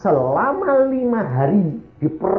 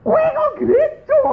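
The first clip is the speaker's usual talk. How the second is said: teriakan tanya bernada sangat tinggi; kaget tak percaya; 6 dB louder than usual. Retold or imitated. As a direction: tirukan suami terperanjat, keras melengking. Suami kaget istrinya ke gereja. (suami menanyai istrinya, memekik heran)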